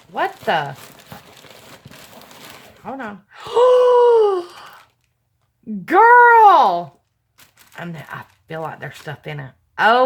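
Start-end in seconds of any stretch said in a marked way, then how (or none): 3.4-4.7 sharp, high gasp of surprise; big delighted gasp. Real, not acted. Vlogger unboxing a gift from a viewer, loud delighted gasp. 5.8-7.0 excited shout. Real, not acted. Vlogger reacting to a gift from a viewer, excited shout.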